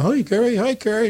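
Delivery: lightly acted excited hellos; imitated fan greetings. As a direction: mimic a crowd hailing a star. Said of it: "everybody's going".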